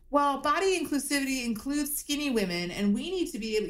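high, preachy influencer voice; mock Hilaria. Mocking Hilaria's inclusivity talk to friends, high and preachy.

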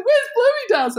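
high, breathless question; giddy eagerness. Retold or imitated. Asking the shop girls the way, giddy. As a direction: ask it, thrilled and rushing.

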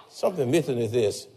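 low, soft repeated gripe; grumbling husband. Retold or imitated. Husband grumbling at wife's cooking.

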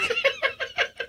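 real laugh shooting way up; high burst of laughter. Real, not acted. Laughs at the bad take.

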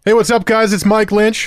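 big radio host intro; loud intro. Real, not acted. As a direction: pump up the show opening.

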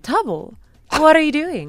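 lightly voiced news reporter; a hint of a reporter asking questions. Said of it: "I love this reporter voice".

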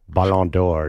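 "Ballon d'Or" said the French way; French pronunciation. Shown as a demonstration. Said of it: "is how it's pronounced".

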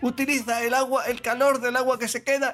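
high, singsong Galician mother explaining; acento gallego. Retold or imitated. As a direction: imitate a Galician mother patiently giving a science lesson. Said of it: "acento gallego". (madre gallega que explica, voz aguda y cantarina)

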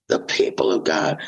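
hushed reverent repeat; whispered echo. Real, not acted. Echoing the phrase right after.

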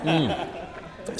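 savoring a sip, humming; a satisfied hum mid-sip; while sipping a drink. Real, not acted. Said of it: "I'm drinking as you talk".

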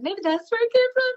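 put-on high, cutesy baby talk. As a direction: coo it like talking to a dog. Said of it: "my baby voice for a second".

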